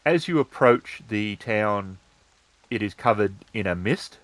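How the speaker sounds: ordinary talk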